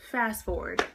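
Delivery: ordinary talk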